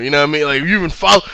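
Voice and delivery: normal talking voice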